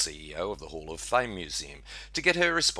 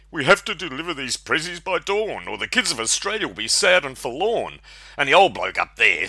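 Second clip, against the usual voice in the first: reindeer voice; 8 semitones higher, 8 dB louder than usual. Acted. Rudolph answers the snake.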